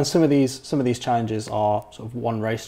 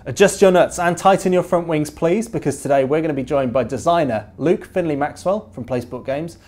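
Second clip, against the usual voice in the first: bright, upbeat show-host opening, a touch higher; podcast host intro; 5 semitones higher, 5 dB louder than usual. Acted. Host opening the podcast to its listeners, upbeat presenter read. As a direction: welcome listeners like a radio presenter.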